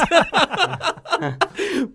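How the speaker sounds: shrill helpless giggle; high squealing laugh. Real, not acted. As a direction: let out a high cackle. Bursts out laughing.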